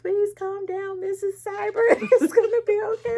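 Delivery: high, fast mock soothing voice; a hammed-up calming voice, laughing; imitating the contractor. Contractor on the phone calming a crying client, high mock soothing. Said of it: "they're like".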